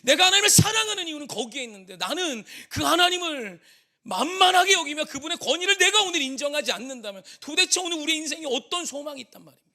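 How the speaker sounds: high, loud, impassioned sermon delivery; full-force preaching. Real, not acted. Preacher urging the congregation on God's patient love, impassioned. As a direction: preach it out at the top of your voice.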